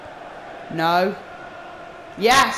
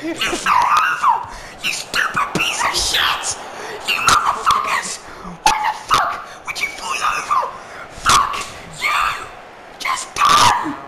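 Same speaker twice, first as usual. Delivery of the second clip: cracked, squeaky, much higher screech of rage; voice cracking. Real, not acted. Gamer screeching at his player until his voice cracks. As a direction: voice breaks up into a strangled squeal. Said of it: "What happened to my voice there?".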